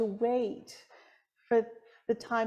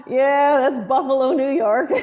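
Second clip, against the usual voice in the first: emphatic reply; 7 dB louder than usual. Retold or imitated. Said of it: "It's like".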